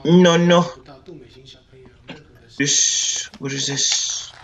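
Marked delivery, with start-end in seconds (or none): 0.1-0.6 startled no. Real, not acted. Scared gamer at sudden event.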